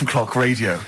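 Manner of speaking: ordinary delivery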